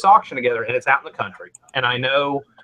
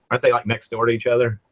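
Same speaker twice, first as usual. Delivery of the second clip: normal talking voice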